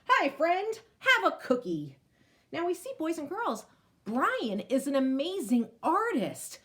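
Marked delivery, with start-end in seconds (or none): 0.1-2.0 put-on higher, louder welcoming kid voice; lightly voiced friendly child. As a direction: offer the cookie sweetly. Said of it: "this other kid says".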